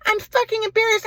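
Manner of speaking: very high-pitched, hammed-up berating mom; mock a mother's furious tirade in falsetto; high mock-mom voice, heaping on scorn; imitating an angry mother. Imitating mother insulting her son.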